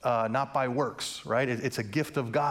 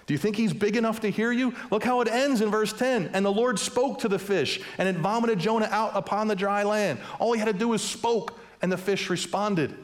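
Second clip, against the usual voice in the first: fiery preaching; 6 semitones higher than usual. Acted. Pastor urging the church to believe, preaching hard. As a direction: drive each line home to the congregation. Preaching rises to full force.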